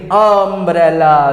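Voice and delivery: अम्ब्रेला slow, first sound a short open a; open a start. Shown as a demonstration. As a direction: draw out the a of अम्ब्रेला. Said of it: "इस अ का sound change हो गया".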